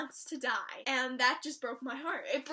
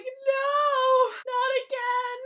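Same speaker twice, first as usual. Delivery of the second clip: high loud pained wail; anguished cry; 10 semitones higher, 10 dB louder, much slower than usual. Retold or imitated. Fan reacting to Dean's heartbreak, anguished wail. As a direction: plead against the loss. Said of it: "And I was like".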